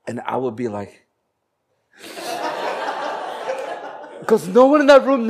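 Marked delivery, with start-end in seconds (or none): 2.0-4.2 airy silent chuckle; breathy laugh. Real, not acted. Laughing at credited person. 4.3-5.3 much higher, louder, laughing through it. Real, not acted.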